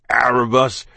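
loud, hoarse address. A narrator's reading. Beaten captain addressing his attacker, hoarse. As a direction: call the name hoarsely with force. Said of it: "said in a hoarse voice".

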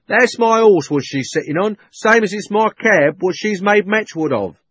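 loud, high indignant complaint; cockney cabby. A narrator's reading. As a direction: claim his horse and cab.

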